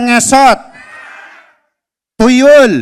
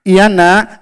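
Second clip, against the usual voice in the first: lower, calm fatherly reply; 4 semitones lower than usual. Acted. Father answering his son Abdi, low and calm. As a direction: answer as a gentle dad.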